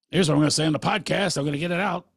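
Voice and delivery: psyching himself up to blurt it out; fired-up inner voice. Retold or imitated. Rehearsing the podcast to himself, fast and fired up. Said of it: "I talk to myself in the shower".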